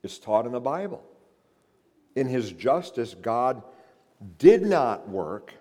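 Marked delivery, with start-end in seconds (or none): none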